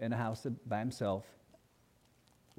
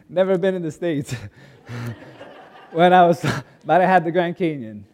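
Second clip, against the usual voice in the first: laughing; 4 semitones higher, 13 dB louder than usual. Real, not acted. Laughing at himself.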